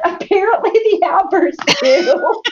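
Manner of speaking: high, slow, then a big laugh; deadpan then burst out laughing. Real, not acted. Mom answering her son, then laughing. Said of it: "And I said".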